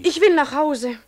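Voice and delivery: insistent. Acted. René insists on going home.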